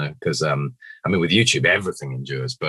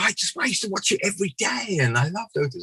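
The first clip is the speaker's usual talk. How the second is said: high-pitched starstruck gush; mock fan excitement; imitated gushing fan. Imitates fan blown away, gushing.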